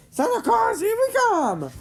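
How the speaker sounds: very high, loud whoop of joy; joyous shout. Acted. Carl cheering as they set off, joyous high shout. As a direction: shout it out with glee. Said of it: "Carl shouted joyously".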